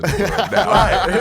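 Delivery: high, loud quote through laughter; laughing mock quote. Host teases guest's reply to the question, laughing mimicry. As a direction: burst into laughter while mimicking him. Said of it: "He like".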